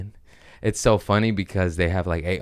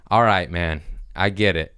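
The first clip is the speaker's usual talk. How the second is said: ordinary delivery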